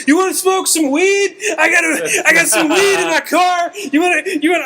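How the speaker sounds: very high, frantic invitation; excited shouting. Retold or imitated. Fan invites star out to his car, excited shouting. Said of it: "I don't even realize I'm shouting. I'm so excited".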